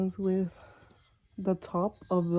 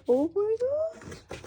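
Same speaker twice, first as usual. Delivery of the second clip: very high, drawn-out thin squeal; excited squeal; 4 dB quieter, slower than usual. Real, not acted. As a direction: squeal slowly upward in delight. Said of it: "I'm so excited".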